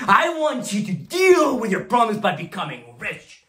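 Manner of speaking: high, driving drill-it-in harangue; fiery exhortation. Acted. Boss pushes broke brokers to start dialing, fiery exhortation. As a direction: fire up the troops, punching each order.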